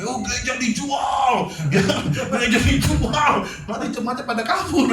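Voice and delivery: mock gossipy shout about the church being sold; mock gossip. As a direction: shriek it like a scandalised neighbour. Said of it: "orang kalau gosip kan begitu".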